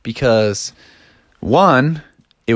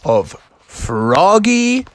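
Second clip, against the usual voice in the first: slow, drawn-out read-along host; storybook recording intro; 7 semitones higher than usual. Acted. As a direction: announce the tale with stretched words.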